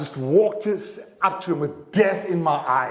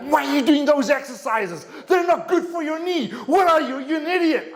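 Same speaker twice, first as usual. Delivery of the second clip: much higher, mock furious doctor yelling; retold angry yelling; 10 semitones higher than usual. Doctor yells at patient over his exercises, high mock shout. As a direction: pitch it up as the doctor loses his temper. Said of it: "he just started yelling".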